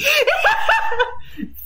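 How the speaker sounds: high-pitched shaky laugh after a scare; nervous laugh. Real, not acted. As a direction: laugh off the fright.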